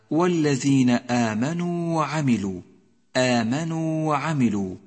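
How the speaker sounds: آمنوا intoned with a held final oo; full long vowel. Shown as a demonstration. Teacher reciting the phrase under study, آمنوا with full long oo. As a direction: stretch the و of آمنوا before وعملوا. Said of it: "إياك أن تبتر حرف المد".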